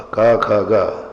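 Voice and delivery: का, ख, ग said slowly and loudly with no nasal; slow loud का, ख, ग, plain release; non-nasal letters. Shown as a demonstration. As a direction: clear stops, no nasal, for contrast. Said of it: "कहाँ पड़ा?".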